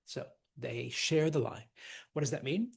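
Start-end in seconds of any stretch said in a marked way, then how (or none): none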